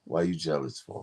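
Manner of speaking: low teasing question; deep flirting voice. Pretend. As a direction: needle her with the pitch dropped. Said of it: "why the fuck you talk, talk normal".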